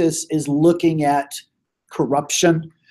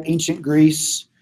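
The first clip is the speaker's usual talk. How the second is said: usual speaking voice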